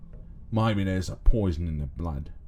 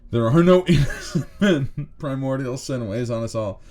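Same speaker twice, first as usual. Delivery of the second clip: mock menacing preacher; 6 semitones higher, 6 dB louder than usual. Character line voiced with laughter.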